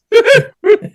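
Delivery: high, short giggle burst, cut off; controlled high giggle played like an instrument; directed giggle. Shown as a demonstration. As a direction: giggle twice, then suppress the third. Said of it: "just kinda do two and then suppress the third".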